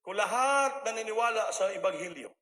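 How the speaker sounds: loud preaching call to a hospital ward; preaching shout. Retold or imitated. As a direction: project it across the rooms. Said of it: "sumisigaw ako".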